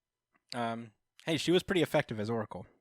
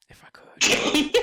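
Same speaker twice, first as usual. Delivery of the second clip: joke whisper; 11 dB louder, slower than usual. Acted. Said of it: "I would have done it".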